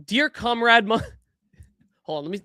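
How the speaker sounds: ordinary talk, nothing marked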